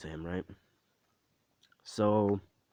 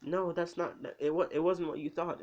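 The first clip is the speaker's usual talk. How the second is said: high-pitched, stumbling mock denial; imitating a flustered excuse; kind of flustered; 9 semitones higher than usual. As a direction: protest in a high voice, tripping over the words. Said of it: "kind of flustered".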